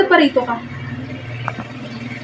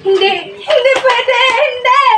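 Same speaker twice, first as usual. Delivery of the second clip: loud, high, tearful shouting; distraught denial; 6 semitones higher, 6 dB louder, slower than usual. Acted. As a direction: cry out in desperate refusal. Mother refuses news; asked why crying.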